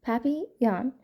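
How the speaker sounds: papillon said carefully, French-style, as "pappy-yon"; French pronunciation. Shown as a demonstration. Nancy showing listeners how to say it, papillon pronounced in French. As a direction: say papillon the French way, rising at the end. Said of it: "You say it like this".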